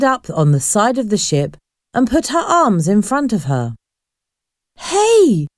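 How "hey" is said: sudden high startled call; alarmed cry at the girl on the rail; surprised. A narrator's reading. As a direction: catch her off guard, voice jumping up. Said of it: "asked Lucy, surprised".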